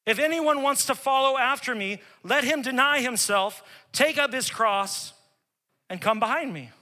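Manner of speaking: fiery scripture reading; Jesus's words preached. Acted. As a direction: proclaim it with force, slowing on take up his cross. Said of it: "Jesus told his disciples".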